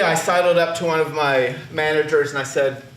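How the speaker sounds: ordinary talk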